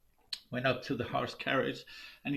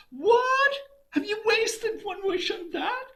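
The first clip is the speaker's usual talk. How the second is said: much higher, louder shrill outrage; outraged scolding; 6 dB louder, slower than usual. Acted. Wife scolds husband for wasting a wish, outraged. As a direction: tear into him over the wish.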